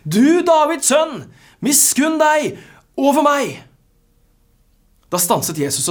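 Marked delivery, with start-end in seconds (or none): 0.1-3.6 very high, full-voiced desperate shout; louder shouting. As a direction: shout it out slowly and loudly, refusing to be silenced. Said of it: "men han ropte bare enda høyere". (rope av full hals)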